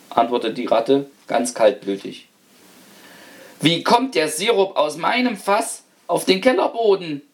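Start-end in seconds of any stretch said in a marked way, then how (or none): 3.6-7.2 fearful roar. A narrator's reading. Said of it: "brüllte der Hausherr mit fürchterlicher Stimme".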